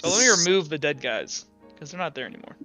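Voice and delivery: ordinary talk, nothing marked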